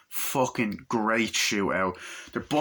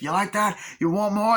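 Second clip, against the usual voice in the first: much higher, loud taunting shout; taunting yell; 11 semitones higher, 3 dB louder than usual. Retold or imitated. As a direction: jeer at them mid-gunfight. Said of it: "He's like".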